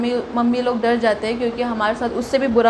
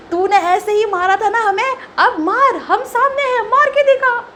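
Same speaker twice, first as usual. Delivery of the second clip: angry challenge; 8 semitones higher than usual. Retold or imitated. To the boy in front of the crowd, a high cracking furious dare. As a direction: dare him to hit you now, shouting.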